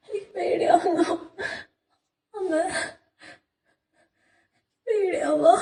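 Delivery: high, tearful sobbing words; crying in fear. Acted. Alone in the locked room, he cries to his mother that he is scared. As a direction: let the fear break into crying.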